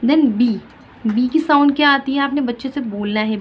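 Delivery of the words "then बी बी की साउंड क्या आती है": usual speaking voice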